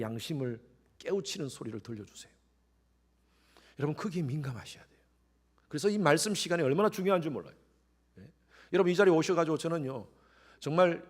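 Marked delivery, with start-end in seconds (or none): none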